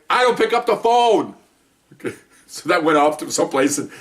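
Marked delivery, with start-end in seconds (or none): 0.1-1.3 high, slow comic declaration; same sitcom indignation applied to himself; Joey. Retold or imitated. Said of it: "Joey doesn't share food".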